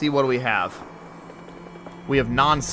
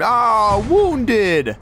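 a louder, higher yelled Ah, wounded; mock scream; 7 semitones higher, 4 dB louder than usual. Gamer retelling what the companion yelled, in a mock scream. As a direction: holler it like the hurt companion. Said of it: "He just screamed".

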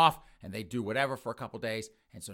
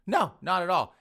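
loud, raised protest; emphatic denial; 7 semitones higher, 9 dB louder than usual. Retold or imitated. Mother denying the girlfriend's accusation, emphatic. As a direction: throw the charge straight back, high and loud.

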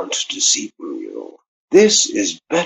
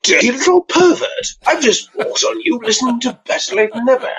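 shrill high squeal of mock outrage, far higher; mock scandalized intruder; 13 semitones higher, 3 dB louder than usual. Voicemail caller pretending to walk in on listener, high mock shriek. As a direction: catch someone in the act, squealing.

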